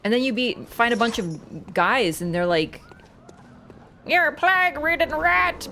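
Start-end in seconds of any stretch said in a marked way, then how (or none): 4.0-5.7 higher, posh-ish mock British sneer; mock British accent. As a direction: play a snooty British NPC, high and sneering. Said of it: "but, like, more Britisher".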